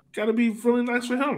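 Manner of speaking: ordinary talk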